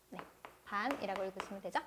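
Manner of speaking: usual speaking voice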